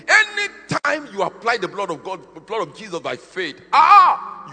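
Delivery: loud, high preaching burst; preacher building to a cry; fiery preaching. Acted. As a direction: lift it into a shouted sermon peak. Preacher rising to full force.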